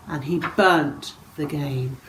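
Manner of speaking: ordinary delivery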